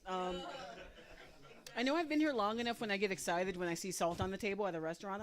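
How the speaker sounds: ordinary talk, nothing marked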